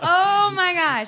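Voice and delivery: slow, high, loud drawn-out exclamation; drawn-out oh my gosh. Real, not acted. To her friend, recalling the trip, stretched high exclamation. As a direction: stretch it out in disbelief.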